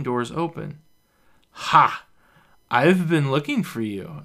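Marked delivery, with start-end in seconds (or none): 1.5-4.2 loud, slurred shout from behind; drunken yell. A narrator's reading. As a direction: yell it out, tipsy and sloppy. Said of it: "a staggering voice yells".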